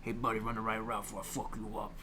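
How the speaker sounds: low, soft tough-guy quarterback impression; lightly played threat in a low New York quarterback voice; gruff New York quarterback. Quarterback threatens his receiver about a route, mock tough. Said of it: "he talks like this".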